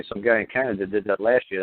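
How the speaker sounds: normal talking voice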